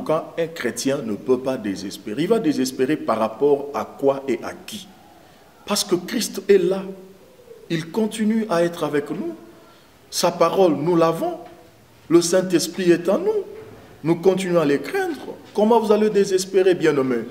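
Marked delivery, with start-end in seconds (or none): 5.6-17.3 rising, impassioned sermon voice; fervent preaching. Acted. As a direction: preach it out with growing fire.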